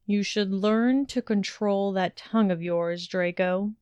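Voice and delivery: ordinary delivery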